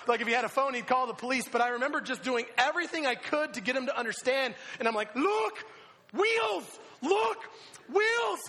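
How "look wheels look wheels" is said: high, loud one-word yells; frantic shouted warnings, each word separate; freaking-out shout. Retold or imitated. Driver trying to get the rider to understand, freaking out. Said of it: "freaking out, losing my mind".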